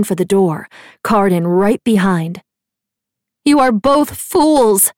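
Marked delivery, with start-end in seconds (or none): 3.4-4.9 shouting. A narrator's reading. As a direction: hurl it after them down the stairs. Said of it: "Nicasia shouted after them".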